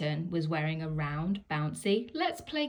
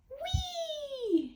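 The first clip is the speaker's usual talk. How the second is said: very high, thrilled whee; gleeful cry. Acted. Piglet sliding down the giraffe's neck, squealing high with glee. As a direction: squeal with delight down a slide. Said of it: "he cried".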